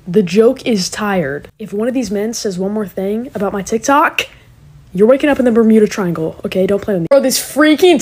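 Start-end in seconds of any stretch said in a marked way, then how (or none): none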